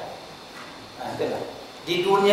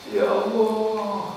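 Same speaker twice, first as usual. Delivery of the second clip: mock weeping child; 4 semitones higher, 4 dB quieter, much slower than usual. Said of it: "Kalau anaknya nangis".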